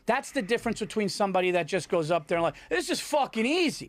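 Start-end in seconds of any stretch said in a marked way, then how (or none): 2.7-3.9 mildly cocky, high-pitched quote; imitating a too-sure performer; mock cockiness. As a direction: toss it off high, like an overconfident comic. Mimics an overconfident comic.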